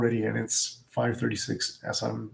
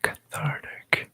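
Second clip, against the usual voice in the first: slow whisper into one side of the mic; ASMR whisper; 4 semitones higher, 3 dB quieter, slower than usual. Shown as a demonstration. Doing ASMR for the viewers into one side, whispered. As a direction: hush it slowly toward one ear. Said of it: "I'm gonna say to the right".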